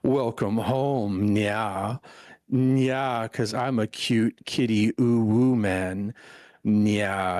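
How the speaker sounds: slow, drawn-out kitty recital; teasing, stretched-out nya performance; cutesy cat-maid act. Pretend. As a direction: recite the cat lines slowly to torment a friend. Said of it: "I will torture you, San, with three lines".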